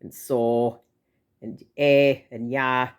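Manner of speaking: slow Yooper go, so, eh, ya; pronounced Yooper accent, slowed right down; up-north Wisconsin accent. Retold or imitated. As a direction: draw out the vowels like an up-north Yooper. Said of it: "it's actually really pronounced".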